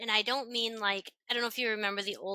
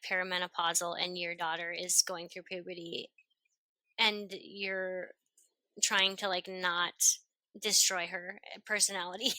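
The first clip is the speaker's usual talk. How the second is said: normal talking voice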